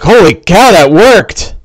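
acted astonishment at a success; surprised. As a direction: blurt it out in surprise. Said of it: "he looks surprised".

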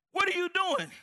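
a sudden high, loud startled question; surprised wife. Retold or imitated. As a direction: blurt it out in shock. Wife sees husband packing too.

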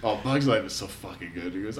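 usual speaking voice

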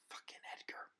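hushed, under-the-breath swear; whispered Fucking Edgar; whispered curse. Acted. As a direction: spit it out in a whisper. Muttered curse under his breath.

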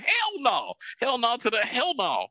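high, outraged hell no from the Chinese side; mock outraged refusal. A refused suitor's father shouting hell no, outraged. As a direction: scoff it out as the offended family. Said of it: "And they're like".

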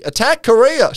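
high, loud mock command; hammed-up war order; Margaret Thatcher. Channeled Thatcher advising on Japanese policy, mock commanding. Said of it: "Margaret Thatcher starts advising".

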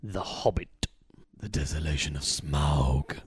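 deep, slow, hushed movie-title announcement; big low announcer voice, drawn out; trailer voice. Acted. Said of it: "Do, do it in the voice".

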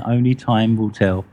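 normal talking voice

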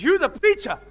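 loud, high challenge to the preacher; mock-hostile shouted question; gruff challenge. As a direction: bark it out like a doubtful farmer. Old farmer challenging the preacher.

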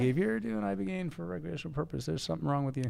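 a teasing, exaggerated put-down; joking mock warning. Joking to guests about taking Ibogaine for fun, mock scolding. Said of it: "I'm like".

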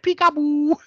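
high, taunting sing-song peekaboo; a playful hammed-up jester taunt; mock creepy peekaboo. Jester voiced peeking in window.

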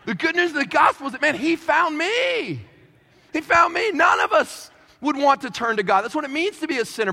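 loud, rising proclamation; fervent preaching. Real, not acted. Preacher proclaiming he found me, loud and fervent. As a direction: build to the gospel peak.

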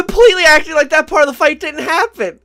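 high, loud, laughing protest; laughing shout. Real, not acted. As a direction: yell it through laughter. Laughing heated accusation.

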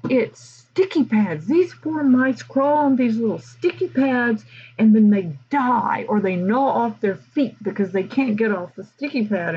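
high, indignant colleague voice, slowing on the start; mock outrage. Theater teacher ranting at lunch, in mock outrage. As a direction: play up her horror at the traps. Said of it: "she was just outraged".

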